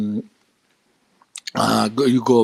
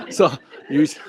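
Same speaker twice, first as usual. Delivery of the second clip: laughing; 4 semitones higher than usual. Real, not acted. Laughs while starting sentence.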